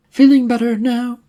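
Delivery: a cheerful nurse who takes charge; brisk nurse. Acted.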